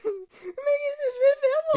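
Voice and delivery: high, sobbing wail; mock sobbing. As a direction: wail to the doctor through fake tears. Heartbroken patient at doctor's.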